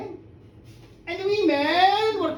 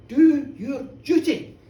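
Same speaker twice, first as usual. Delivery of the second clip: slow, loud incantation; magic command; 6 dB louder, slower than usual. Acted. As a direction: intone the spell.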